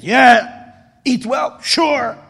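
brisk, hearty coachman voice; coachman's blunt answers. Acted. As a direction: toss back offhand answers. Coachman and Rebbe quick exchange.

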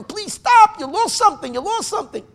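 high-pitched, loud pleading shout; retold hollering. Man chasing a coach to return a lost bag, hollering. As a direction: imitate hollering after someone who won't stop. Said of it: "screaming and hollering".

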